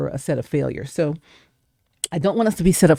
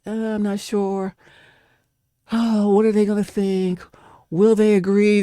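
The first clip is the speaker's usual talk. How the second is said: lightly voiced unsure believer, wavering; hesitant doubter; 3 semitones higher than usual. Preacher mimics a congregant hesitating over God's plan, mildly unsure. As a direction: play the doubter second-guessing herself. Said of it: "You can't just be like".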